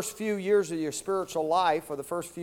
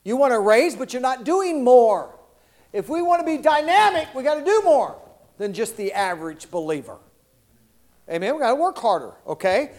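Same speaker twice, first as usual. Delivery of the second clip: ordinary talk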